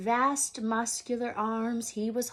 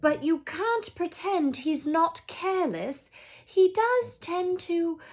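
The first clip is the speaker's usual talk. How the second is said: higher, louder reproach; McGonagall, complaining; 7 semitones higher, 4 dB louder than usual. A narrator's reading. McGonagall complaining to Dumbledore about Hagrid, high and exasperated. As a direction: press the point, exasperated.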